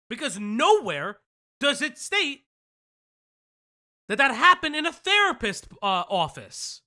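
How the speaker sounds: emphatic. Real, not acted. Heated callout rant.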